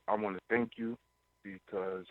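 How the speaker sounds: normal talking voice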